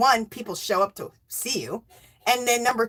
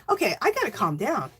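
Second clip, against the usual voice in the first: usual speaking voice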